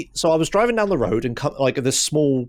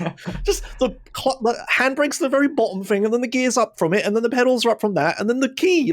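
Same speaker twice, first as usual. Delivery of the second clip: amazed explaining; 9 semitones higher than usual. Real, not acted. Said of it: "explaining the bottom-to-top trick".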